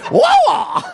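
"wa" shouted very high and loud; high, loud whooped "wa"; exaggerated tone demonstration. Shown as a demonstration. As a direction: blast "wa" up high as a comic tone example. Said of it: "all the different ways you could say".

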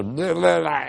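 quick mock-language babble; gurgling imitation of foreign speech; imitated bubbling gurgle. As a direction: burble nonsense syllables with a rolling tongue. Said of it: "It's just all these bubbling, gurgling sounds".

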